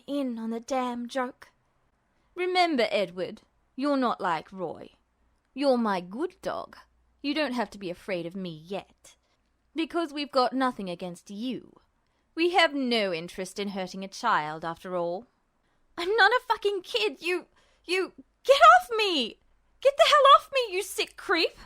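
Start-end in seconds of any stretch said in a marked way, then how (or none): 2.3-15.3 menacing villain. Acted. Justin taunts restrained Ed, low and menacing. 16.0-21.6 high, loud enraged yelling; furious scream. Acted. Ed screams at Justin to get off him.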